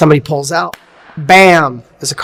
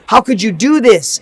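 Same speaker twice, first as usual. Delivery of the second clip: normal talking voice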